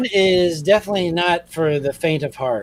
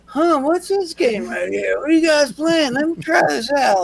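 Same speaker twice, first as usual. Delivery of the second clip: feigning innocent dad; 8 semitones higher than usual. Retold or imitated. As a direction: play dumb like the old man pretending he's never seen the game. Dad feigning ignorance at game.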